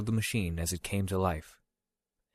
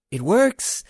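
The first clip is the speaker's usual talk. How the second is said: high, loud delighted exclamation; excited cry; 12 semitones higher, 8 dB louder than usual. Acted. Yuki cheering when the machine works.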